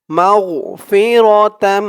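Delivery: মাগফিরাতাম with a humming তাম; nasal tam. Shown as a demonstration. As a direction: hold the nasal ending of মাগফিরাতাম. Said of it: "তাম বলে গুণনা করতে হবে".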